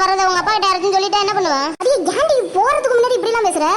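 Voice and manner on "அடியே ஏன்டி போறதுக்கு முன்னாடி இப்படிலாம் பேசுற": higher-pitched exasperated scolding; lively, indignant telling-off. Acted. Friend scolds her friend for talking that way, exasperated.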